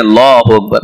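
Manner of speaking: a lightly mocked call to prayer, low; mock a poor muezzin's call; mocking a bad muezzin. Preacher mocking the muezzins hired for the mosques, a bad call. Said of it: "مؤذن ایسے رکھے جاتے ہیں".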